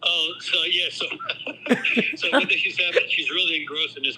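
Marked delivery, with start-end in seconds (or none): 1.1-2.1 a burst of breathy laughter before the story; real laugh. Real, not acted. Dad starts retelling his wife's blunder, bursting out laughing.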